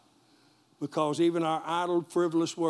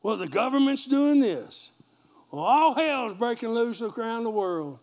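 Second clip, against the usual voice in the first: mock worried gossip; 5 semitones higher, 5 dB louder than usual.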